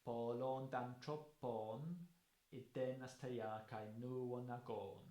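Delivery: very slow, each syllable held long; spondaic recital. Shown as a demonstration. Lecturer showing spondees to students, drawn out and slow. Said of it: "there you can hear the spondies".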